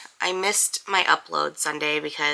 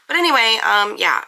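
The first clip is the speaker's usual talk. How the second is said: ordinary delivery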